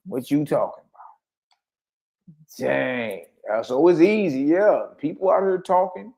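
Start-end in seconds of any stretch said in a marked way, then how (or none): 0.1-3.3 slow drawl. Real, not acted. Preacher reacting to the scripture.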